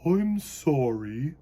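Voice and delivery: slow, sighing apology; sighing giraffe. Acted. Missus Giraffe sighing to Choco, slow. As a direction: let it out slowly like a sigh. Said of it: "sighed Missus Giraffe".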